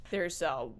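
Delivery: usual speaking voice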